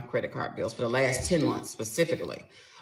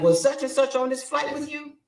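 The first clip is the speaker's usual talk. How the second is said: higher, louder interrogation; pointed question; 10 semitones higher, 5 dB louder than usual. Retold or imitated. Wife interrogating husband about the trip, pointed. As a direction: pin him down. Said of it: "I was like".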